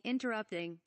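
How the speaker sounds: ordinary delivery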